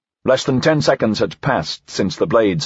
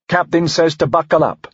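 tag; 7 semitones higher than usual. A narrator's reading. Deputy passes on captain's order.

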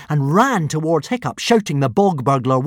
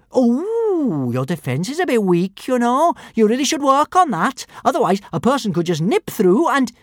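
put-on chatty little girl; 10 semitones higher, 4 dB louder than usual. A narrator's reading. Girl giving casual advice mid-fight.

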